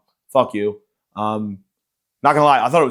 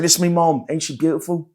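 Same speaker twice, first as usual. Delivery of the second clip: high-pitched mock British accent; hammed-up British speech-giver, pitched up; Prince Harry; 5 semitones higher than usual. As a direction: put on a broad, cheeky English accent. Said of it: "pretended to be Prince Harry on stage".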